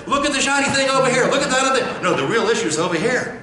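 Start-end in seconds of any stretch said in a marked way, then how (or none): 0.1-2.0 high, loud, fast mock; mocking distraction. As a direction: wave at the shiny thing as a mocking distracter.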